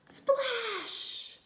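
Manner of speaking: a high, bright Splash!; big splash. Acted. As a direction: shout the splash sound like a cannonball. Reads cannonball splash.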